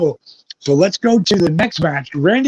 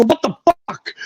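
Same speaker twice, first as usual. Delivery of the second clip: a pitched-up, stunned "What the fuck?"; shocked outburst; 9 semitones higher than usual. Retold or imitated. Wrestler hears from his wife he just wrestled, jumping up in shock. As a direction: jump up in pitch, thrown by the news.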